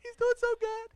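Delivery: overwhelmed proud dad near tears; blubbering with happy tears; teary choked-up joy. Retold or imitated. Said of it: "Tears start welling up my eyes".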